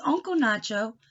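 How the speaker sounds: ordinary talk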